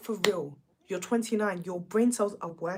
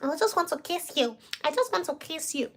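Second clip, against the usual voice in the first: higher mock lovesick plea; mocking Kieran; 7 semitones higher than usual. Mocking Kieran's kisses to Whitney, lightly raised mock plea. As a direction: simper it like a clingy boy. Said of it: "all he knows is kiss".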